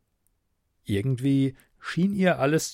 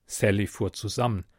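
plain reading voice

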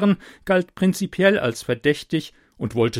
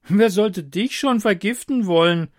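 high, loud mocking question; scoffing jester; 8 semitones higher, 5 dB louder than usual. Jester scoffs at the taster, high and mocking. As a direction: scoff at him. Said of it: "fragte Hofnarr Edward".

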